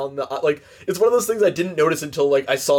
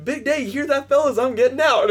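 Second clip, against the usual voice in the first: much higher, eager goofy voice; Ernest, cheerful; 8 semitones higher than usual. Retold or imitated. Friend acting Ernest thrilled about release, high goofy. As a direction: chirp it like a clueless prisoner. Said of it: "he's like".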